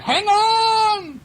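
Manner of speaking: very high, loud drawn-out call; urgent shout. A narrator's reading. Little blue engine to her clown friend, urgent shout.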